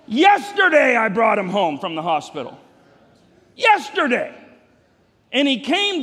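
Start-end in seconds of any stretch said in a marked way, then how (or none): 0.1-4.3 emphatic exclaim. Real, not acted. Pastor exclaiming to congregation how fast son grew. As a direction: hammer home how fast it went. Insisting time flew.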